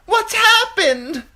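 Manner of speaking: very high, loud, slow frantic demand; frightened noblewoman demanding news; demanding woman. A narrator's reading. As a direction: demand it shrilly in panic. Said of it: "demanded a woman".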